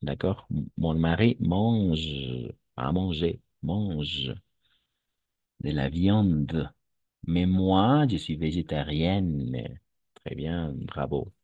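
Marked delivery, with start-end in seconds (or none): 0.5-6.7 slow model reading, mange and viande with silent final e; careful pronunciation. Shown as a demonstration. Teacher correcting a student's reading, slow careful pronunciation. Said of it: "on ne prononce pas". 7.3-9.8 slow model reading, végétarienne ending on a held n; careful pronunciation. Shown as a demonstration. Teacher re-reading the student's sentence, slow careful pronunciation. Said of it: "on ne prononce pas".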